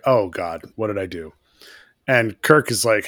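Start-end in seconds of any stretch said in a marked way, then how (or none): 0.1-1.3 low, sinking voice; retold dismay. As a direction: trail off softly in mock dismay. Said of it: "she's like".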